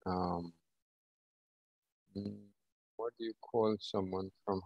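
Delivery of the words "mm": usual speaking voice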